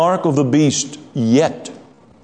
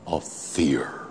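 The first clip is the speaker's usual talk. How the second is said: dramatic whisper; 11 semitones lower, 12 dB quieter than usual. Acted. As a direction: whisper the word fear, much softer. Preacher drops voice on "fear.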